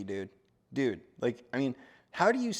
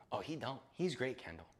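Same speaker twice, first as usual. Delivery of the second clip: ordinary delivery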